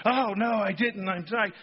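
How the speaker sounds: mock Peter scrambling to deny; panicked denial. Peter denying Jesus by the fire, hammed-up panic. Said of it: "Peter goes crazy".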